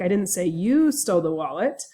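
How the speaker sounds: "you" punched with extra pitch and weight; stress on YOU. Shown as a demonstration. Lecturer demonstrating to students that someone else stole it, stress on YOU. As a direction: hit the word "you" hard. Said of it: "as I move the stress around on these different words".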